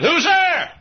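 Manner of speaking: high, loud call through a door; shouted call. Retold or imitated.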